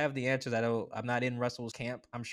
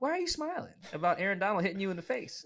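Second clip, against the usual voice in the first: incredulous; 8 semitones higher than usual. Retold or imitated. Incredulous question to Russ.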